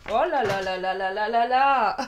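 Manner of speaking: loud startled oh là là; surprised outcry. Real, not acted. Cards tumbling to the floor mid-reading, loud surprise. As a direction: blurt it out as the cards fall.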